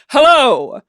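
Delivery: slow, grand mid-Atlantic actor voice; transatlantic Shakespearean accent. Retold or imitated. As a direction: draw it out like a stage Shakespearean. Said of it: "weird transatlantic Shakespearean accent".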